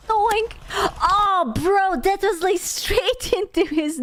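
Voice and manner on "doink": high cartoon "doink"; imitated bonk noise. Player mimicking a hit straight into the noggin, bonk noise. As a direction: pop out a high sound effect.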